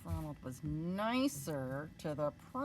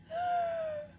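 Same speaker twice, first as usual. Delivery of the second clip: huge sharp inhale. Acted. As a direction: suck in a giant breath. Dragon takes another breath.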